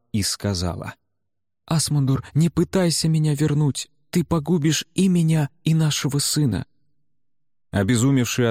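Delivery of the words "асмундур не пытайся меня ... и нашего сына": high-pitched, otherworldly woman's plea; voice of Targunna. Acted. Dead wife warns her husband off, high pleading voice. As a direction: plead in a raised, altered voice.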